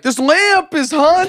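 spooky announcement. Retold or imitated. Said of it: "he's like".

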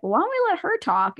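high, quick retort; mock protest. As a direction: imitate a protest, pitched well up. Acts out quarrel over date.

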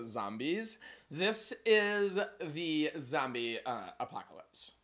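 put-on a light Valley Girl voice with lots of like. Time travelers chatting in a Valley Girl accent. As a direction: play it ditzy and breezy, Valley Girl. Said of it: "What about Valley Girl?".

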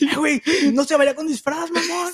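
high, loud mocking yell; mock shout. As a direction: yell it at him like a thug. Acting the masked killer shouting.

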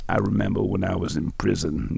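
softly put-on voice, changed timbre; Doctor John impression. Retelling Doctor John's answer about that time, soft imitation. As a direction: imitate him recalling his past, voice dropped soft. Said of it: "Pretty good imitation".

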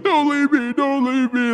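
high-pitched mock wail of someone being left behind; hammed-up panicked begging; imitated crying plea. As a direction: plead in a high, crying voice, mimicking him. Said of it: "he's crying behind me".

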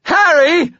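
high, loud cry of horror; piercing, horrified yell; horrified scream. A narrator's reading. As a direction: scream the name in panic. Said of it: "Butters screamed, his voice horrified".

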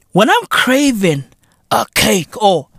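usual speaking voice

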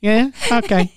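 ordinary delivery

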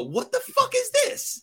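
high, outraged What the fuck is this; a boss shocked by bad work; mock outraged boss. Boss mocking an employee's project, outraged high yelp.

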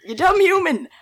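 hammed-up scornful jab; mock insult.

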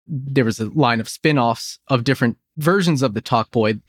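ordinary talk

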